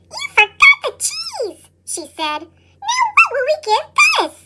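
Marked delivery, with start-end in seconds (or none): 0.1-1.6 much higher mouse voice, shouting; squeaky scolding shout. Acted. 2.9-4.4 much higher mouse voice, crying out; squeaky wail. Acted. Squeak upset at Pip.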